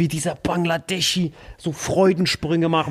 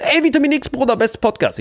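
excited fan; 9 semitones higher than usual. Retold or imitated. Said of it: "die so".

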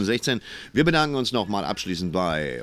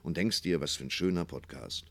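soft, lowered storytelling voice; gentle fireside murmur, fading lower and softer; cosy bedtime sign-off; 4 semitones lower, 7 dB quieter than usual. Acted. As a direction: lull the listener towards good night.

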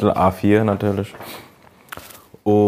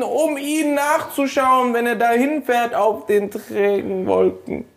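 high, slow, overblown stage actor; theatrical recital; 14 semitones higher, slower than usual. Pretend. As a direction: declaim it like a ham actor. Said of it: "sehr theatralisch bitte".

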